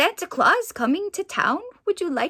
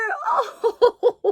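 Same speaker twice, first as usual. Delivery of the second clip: delighted laugh; 11 semitones higher, 4 dB louder than usual. Real, not acted.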